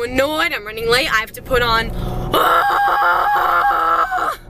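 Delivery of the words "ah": a high, loud wordless shriek; exasperated shriek. Real, not acted. Late for prom pictures, a loud exasperated shriek. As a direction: let out the stress in one cry.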